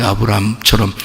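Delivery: normal talking voice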